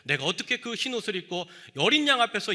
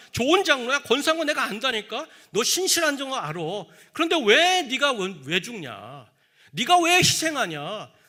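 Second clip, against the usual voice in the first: higher, louder coaxing devil voice; tempting devil; 6 semitones higher, 5 dB louder than usual. Acted. The devil tempting a faithful elder, coaxing loudly. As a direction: flatter and goad, pressing hard. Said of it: "마귀가 찾아와서 속삭일 겁니다".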